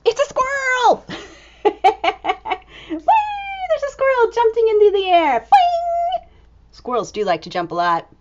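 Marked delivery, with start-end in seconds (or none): 0.1-1.1 high delighted exclamation; surprised reveal. Acted. Reader to children, high delighted reveal. 2.9-6.2 very high, fast, bouncy squeals; gleeful squeal. Acted. Squirrel jumping in air, high gleeful squeal.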